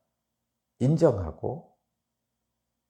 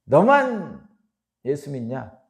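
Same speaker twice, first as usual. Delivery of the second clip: mocking taunt; 3 semitones higher, 6 dB louder, slower than usual. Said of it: "이렇게".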